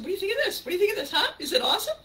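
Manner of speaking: high, loud eager fishing for praise; eager asking. Retold or imitated. Speaker mimics herself asking friends about an outfit, eager. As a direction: beg for a compliment, laughing. Acted eager asker, laughing.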